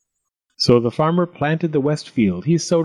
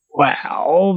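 doubtful goblin; 5 semitones higher than usual. A narrator's reading. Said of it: "scratched his head".

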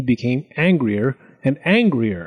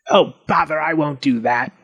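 lazy goblin; 2 semitones higher than usual. A narrator's reading. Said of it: "yawned and leaned lazily".

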